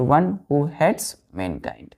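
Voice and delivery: slow, deliberate phrasing; slow dictation. Shown as a demonstration. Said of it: "एक word misanthrope".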